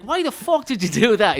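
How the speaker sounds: high, loud furious mother; angry mother. Retold or imitated. Mother scolds father for the bees, high loud anger. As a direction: mom yells at dad in disbelief. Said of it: "my mother's like".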